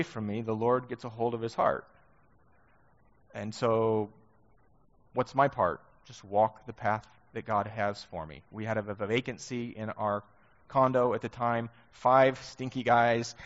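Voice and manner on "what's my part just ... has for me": ordinary talk, nothing marked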